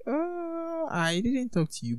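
high, squeaky mock confusion on "Uh", easing down after; mock puzzled reply. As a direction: play a baffled bystander for a laugh. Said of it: "And you're like".

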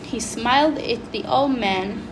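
reading a story aloud for class; a pupil reading the book out to the class; story narration. A narrator's reading. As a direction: read it like a schoolbook passage. Reads story excerpt aloud.

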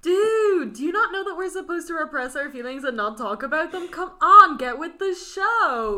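high, loud comic scolding; hammed-up exasperated outburst, stretched at the end; mock exasperation. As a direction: berate a clueless pal, playfully outraged. Mock scolding of a book character.

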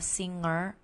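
"singer" with a nasal ng and no hard g; ng demo word. Shown as a demonstration. Teacher models the ng sound in singer. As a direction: hum through "ng" in "singer". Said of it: "Itu buat ang gitu ya".

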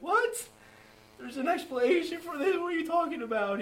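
stunned and incredulous; shocked disbelief. Retold or imitated. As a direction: blurt it out in amazement. Shocked reply to Pastor Pete.

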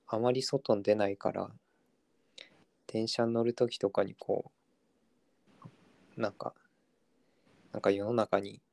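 hoarse from not talking all day; rough, dry throat from disuse; gravelly voice. Real, not acted. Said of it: "声が枯れてる人みたいな感じなんだけど". (声が枯れたかすれ声)

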